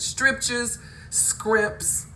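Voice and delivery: "Strictures, scrimps" as loud, high "stripchers, scrips"; aunt's mispronunciation. Retold or imitated. Quoting aunt's church words, loudly mispronounced "Strictures, scrimps". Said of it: "my aunt has said".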